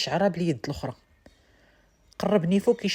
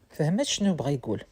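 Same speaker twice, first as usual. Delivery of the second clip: plain reading voice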